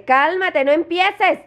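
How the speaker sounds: high-pitched warning to a pet; scolding. Real, not acted. Owner warns dog not to start, scolding.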